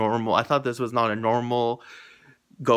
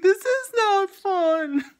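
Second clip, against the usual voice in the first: high, slow, fake-teary whine; hammed-up weepy complaint; mock crying; 6 dB louder, much slower than usual. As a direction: sob it out in playful despair. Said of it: "I was so like".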